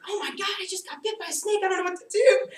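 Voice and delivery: put-on high frantic kid voice, laughing; high panicked shout to dad about the snake; panicked scream. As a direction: squeal it out in a panic. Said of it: "I start screaming at my dad".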